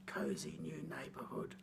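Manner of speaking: ordinary delivery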